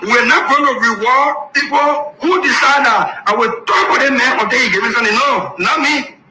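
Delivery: shouted declaration. Real, not acted. Clip replayed, shouting.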